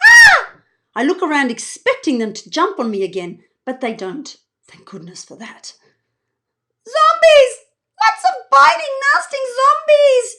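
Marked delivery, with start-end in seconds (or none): 0.0-0.6 piercing high scream. Acted. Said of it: "he screams like those zombies". 6.9-10.3 frantic high yell. Acted. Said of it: "he yells".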